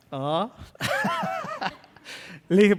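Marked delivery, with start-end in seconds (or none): 0.1-0.5 Ah said lower, a dry Mexican shrug sound. Shown as a demonstration. Pastor answers his English professor, low Mexican Ah. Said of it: "Como decimos los mexicanos".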